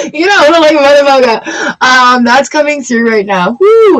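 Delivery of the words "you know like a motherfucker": laughing. Real, not acted. Laughs at own joke.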